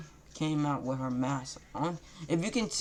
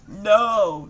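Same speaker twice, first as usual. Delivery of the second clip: scream; 7 semitones higher, 12 dB louder than usual. Acted. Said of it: "Naruto screamed".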